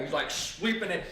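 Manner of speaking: usual speaking voice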